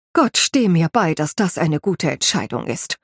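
usual speaking voice

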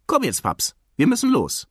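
put-on high, eager child's voice; impatient little boy. Impatient child urges father to leave. (ungeduldige hohe Kinderstimme)

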